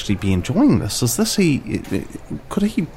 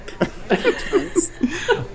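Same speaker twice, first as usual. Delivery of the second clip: high giggle; 4 dB quieter than usual. Real, not acted. High laugh burst.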